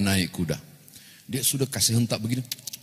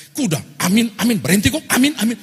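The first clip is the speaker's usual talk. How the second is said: high, loud desperate amin; frantic loud amins; 11 semitones higher, 7 dB louder than usual. Retold or imitated. Pastor shouts amin at the horse on the cliff edge.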